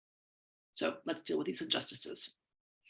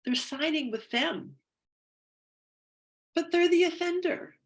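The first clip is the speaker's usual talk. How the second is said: louder, higher protest that peaks on the offender; indignant outburst; 11 semitones higher, 6 dB louder than usual. Acted. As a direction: let the unfairness burst out. Voicing grievance while tapping.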